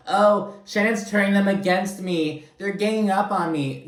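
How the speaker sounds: mocking poor-me voice; mock whiny victim. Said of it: "Tamara's reaction to this is like".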